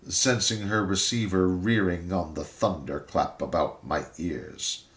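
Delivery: usual speaking voice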